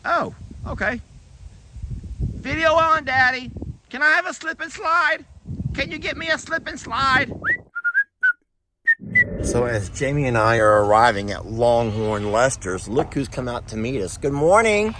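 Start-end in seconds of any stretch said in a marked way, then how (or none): 0.1-7.3 put-on much higher, whiny little kid voice; high goat kid voice. Goat owner voicing Grinch the goat begging Dad for a slip and slide, high whiny kid voice. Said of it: "Grinch is like". 14.2-14.9 higher, sing-song voice for animals. Real, not acted. Farmer greeting her goats at the barn in higher cooing baby talk.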